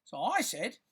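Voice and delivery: ordinary delivery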